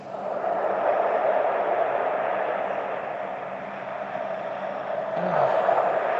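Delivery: long, breathy release sigh; long relieved sigh. Real, not acted. Stretching tense shoulders, a long sigh of relief. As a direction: breathe the tension out.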